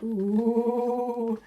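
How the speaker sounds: ordinary delivery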